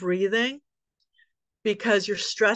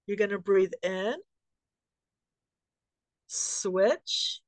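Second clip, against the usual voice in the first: sparse cues in a lowered voice, long silences between; guided breathing; 2 semitones lower, slower than usual. Shown as a demonstration. Yoga teacher leading a class through alternate-nostril breathing, guided breathing cues. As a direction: count them through each breath with brief, settled commands.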